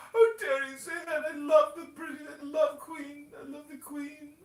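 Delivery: high, loud mock outrage from a devoted royal fan; over-the-top shocked monarchy lover; scandalized royalist. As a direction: gasp and gush over the Queen, very high-pitched.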